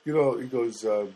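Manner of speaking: usual speaking voice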